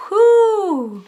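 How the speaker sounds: high, loud joyful whoop; excited whoop. Real, not acted. Reader whooping with joy over the cards, loud and high. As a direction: let out a big happy cheer.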